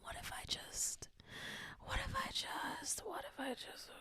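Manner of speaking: whispered musing. Retold or imitated. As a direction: hush it to yourself. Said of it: "I've just been like".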